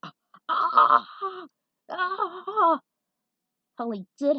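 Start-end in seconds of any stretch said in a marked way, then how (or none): 0.0-2.8 high, loud pained cries; yelps of pain. Acted. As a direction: cry out as the arm is twisted. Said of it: "Gavin yelled in agony".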